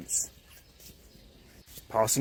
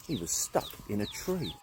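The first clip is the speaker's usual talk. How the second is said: ordinary delivery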